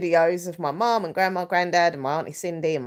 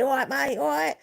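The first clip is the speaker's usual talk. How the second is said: higher-pitched Cockney impression; common Cockney; 8 semitones higher than usual. Imitating granddad's London talk for viewers, common Cockney. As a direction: put on a cheeky Cockney greeting, pitched up. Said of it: "kind of common Cockney".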